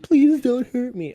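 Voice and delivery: high-pitched imitation of pleading; mock begging. Friend mimicking Charlie pleading not to be shot, mock begging. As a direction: imitate a victim begging for mercy. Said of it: "begging, begging".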